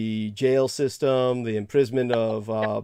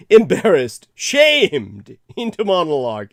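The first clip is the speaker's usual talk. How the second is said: laughing it out, much louder and higher; laughing embarrassment; 4 semitones higher, 8 dB louder than usual. Real, not acted. Host laughing off being shamed into a monologue. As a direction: burst out laughing through the words. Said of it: "I was guilted".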